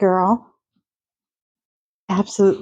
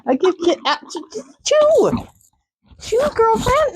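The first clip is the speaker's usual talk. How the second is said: squeaky much higher horse voice, chewing; voicing the horse; 14 semitones higher than usual. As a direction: pipe it up high like a horse mid-chew.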